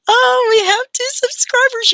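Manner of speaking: high, loud delighted squeal; thrilled high cry of joy; excited. Real, not acted. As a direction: burst out with delight at the news. Thrilled at new subscribers.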